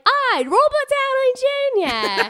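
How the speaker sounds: high, loud, drawn-out Australian greeting; slow, over-the-top Australian accent; exaggerated Australian accent. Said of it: "like, strong accent".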